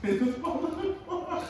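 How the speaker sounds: laughing as she speaks; words broken by laughter. Real, not acted. Snack crumbling, laughing.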